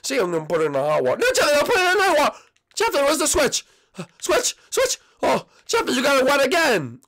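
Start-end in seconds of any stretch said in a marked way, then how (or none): 1.2-2.4 fast, high, loud objection; panicked yell. Acted. Junior objects to Jeffy's hot water plan, panicked. 2.8-7.0 high-pitched, distressed cries; panicked. Acted. Junior finds the Switch wet again, panicked.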